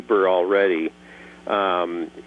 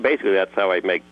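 normal talking voice

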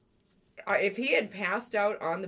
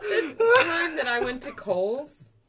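laughing; 7 semitones higher, 9 dB louder, slower than usual. Real, not acted. Wife cracking up remembering.

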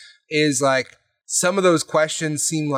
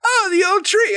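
much higher, louder, eager character voice; a hammed-up impression, pitched far above the teller's own voice; imitating Kate; 4 dB louder than usual. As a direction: chirp it out like an over-keen player in character. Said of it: "being in character and stuff".

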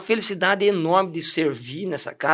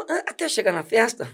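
usual speaking voice

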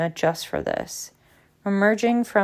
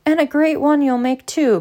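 loud, high, hearty boom; 8 semitones higher, 5 dB louder than usual. A narrator's reading. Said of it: "boomed Slughorn".

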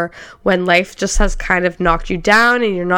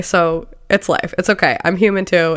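usual speaking voice

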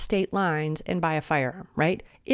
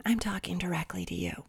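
breathy stage whisper straight to the viewer; soft confiding whisper; 4 semitones lower, 10 dB quieter than usual. Acted. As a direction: lean in and whisper it, conspiratorial. Repeats line to the crazies.

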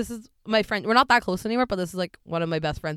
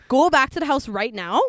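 louder, higher retold order to come back; insistent mock yell; 5 semitones higher, 6 dB louder than usual. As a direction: yell it like ordering a friend home. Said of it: "I remember I yelled at her".

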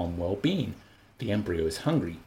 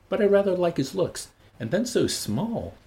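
fond; 9 semitones higher, 3 dB louder than usual. Acted. Lenina fondly describes Bernard.

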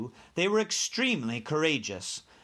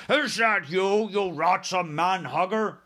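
giant's bellow; 4 semitones higher, 4 dB louder than usual. A narrator's reading. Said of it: "he bellowed".

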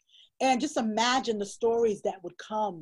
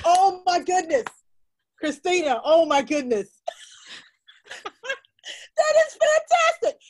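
much higher and louder, laughing; delighted exclamation; 9 semitones higher, 8 dB louder than usual. Real, not acted. Author delighting in the host's grandma story, high laughing excitement. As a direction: gush with delight.

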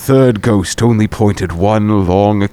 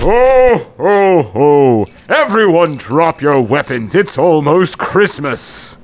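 Santa voice; 2 semitones higher than usual. Acted. Santa stops the fight.

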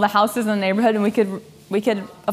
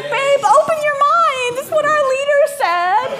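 high, louder sing-song reassurance; much higher mock preachy; 4 dB louder than usual. Wife to fuming husband before the realtor, high mock preachy. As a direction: ham up the wise-counsel tone.

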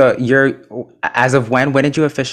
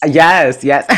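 usual speaking voice

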